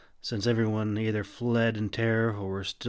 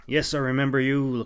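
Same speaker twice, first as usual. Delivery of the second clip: louder, biting snarl; a touch of a snarl, raised pitch; 4 semitones higher, 6 dB louder than usual. A narrator's reading. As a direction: spit it back at her with a louder snarl. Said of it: "Clove snarled".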